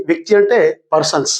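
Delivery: ordinary talk, nothing marked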